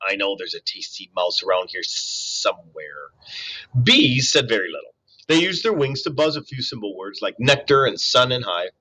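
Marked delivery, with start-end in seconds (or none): 0.1-3.1 low, softer sly snake voice; hissing snake. A narrator's reading. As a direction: mutter it to yourself like a hungry snake. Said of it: "Snakes often hissed to themselves".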